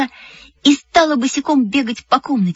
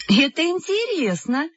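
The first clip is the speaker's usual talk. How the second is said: high-pitched, softly exclaimed delight; lightly amazed, lifted in pitch; interested exclamation; 8 semitones higher, 4 dB quieter than usual. A narrator's reading. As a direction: exclaim with a mother's keen interest. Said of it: "воскликнула мама".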